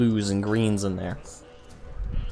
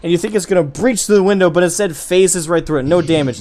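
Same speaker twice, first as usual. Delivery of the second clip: fast, loud and high; excited narration; 6 semitones higher, 6 dB louder, faster than usual. Acted. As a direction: rush it out with excitement.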